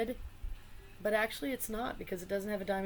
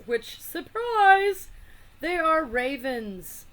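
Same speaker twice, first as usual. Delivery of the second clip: mock surprise; 8 semitones higher, 8 dB louder, much slower than usual. Revealing ravens in deck.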